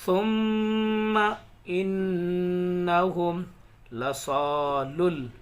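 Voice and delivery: slow, loud, drawn-out tajweed recitation; measured recitation of verses; Quran recitation. Shown as a demonstration. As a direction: chant each word slowly with long pauses. Recitation continues.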